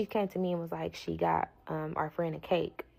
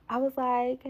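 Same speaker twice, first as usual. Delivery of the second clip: laughing; 7 semitones higher, 3 dB louder than usual. Real, not acted. Laughing while retelling.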